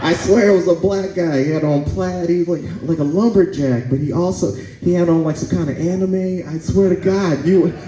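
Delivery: high, excited mock neighbor report; lightly voiced gossiping neighbor. Neighbors describing him to police.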